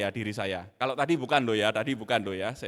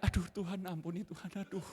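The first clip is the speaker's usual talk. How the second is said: remorseful plea; 2 semitones higher, 7 dB quieter than usual. Retold or imitated. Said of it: "mukanya nggak enak".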